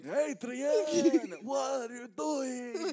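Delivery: high, loud, stretched-out holler; Rocky impression. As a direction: yell it slowly like a hammed-up boxer. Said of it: "that's your go-to Rocky".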